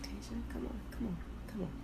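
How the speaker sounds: normal talking voice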